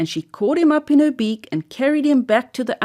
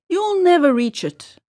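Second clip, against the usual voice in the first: a higher, mildly startled little bird voice; lightly voiced Miss Wren crying out; 7 semitones higher than usual. A narrator's reading. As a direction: sing out the doubt like a small bird. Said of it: "cried Miss Wren".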